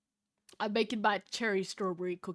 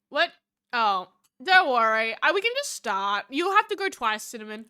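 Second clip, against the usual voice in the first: ordinary talk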